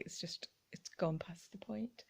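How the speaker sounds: very soft whisper; whispered aside. Real, not acted. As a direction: breathe it out quietly. Admitting too much stuff.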